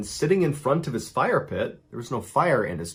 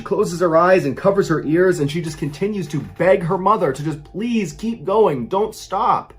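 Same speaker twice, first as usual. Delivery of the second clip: narration pushed higher and louder; rising alarm; 5 semitones higher, 4 dB louder than usual. A narrator's reading. Narrator telling of the daughter hiding in the footwell, rising alarm. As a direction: tell it as the terror climbs. Narrator voices mounting terror.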